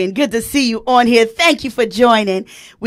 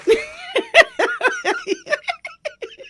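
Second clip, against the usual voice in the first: high shrieking laugh. Real, not acted. Host cracks up.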